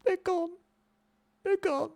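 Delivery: very high, softened squeak of disbelief; small high voice, acting out the loss; retold dismay. Recalling the discovery of missing cards, high hushed dismay.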